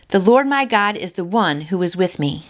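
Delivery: usual speaking voice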